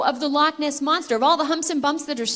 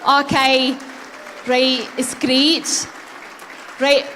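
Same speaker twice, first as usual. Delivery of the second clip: put-on Scottish accent; 5 dB louder, much slower than usual. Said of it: "fantastic Scottish accents".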